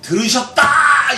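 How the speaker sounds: high, loud, slow declaration; triumphant proclamation. Acted. Preacher voicing the psalmist's certainty, triumphant and slow. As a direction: declare it like a victory.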